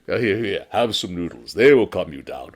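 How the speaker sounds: steady and calm against the panic; calm noodle seller. Acted. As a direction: noodle seller calmly asking what is wrong.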